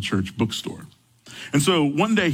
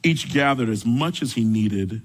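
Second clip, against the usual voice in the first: measured, reverent Bible reading, pitched lower; scripture reading; 4 semitones lower than usual. A narrator's reading. Pastor reading Exodus aloud to his congregation, reverent and measured.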